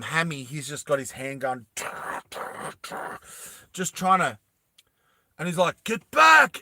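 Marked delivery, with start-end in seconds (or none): 5.8-6.6 high, loud warning yell. Acted. Hammy with his handgun, yelling a warning. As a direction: shout it urgently. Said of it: "he starts yelling out".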